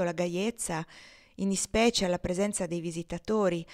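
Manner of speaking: ordinary delivery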